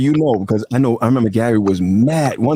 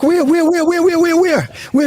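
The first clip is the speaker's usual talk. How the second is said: very high, frantic repeated question; rapid-fire squeal of comic alarm; mock panic; faster than usual. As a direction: act out frantically searching in a fright. Acts own panicked looking round.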